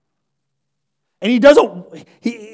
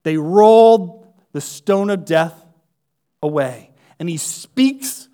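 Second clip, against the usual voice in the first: ordinary talk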